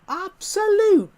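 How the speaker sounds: high, loud, drawn-out wail of exasperation; mock outraged yell. Player scolding over a shovel mix-up, mock outraged yell.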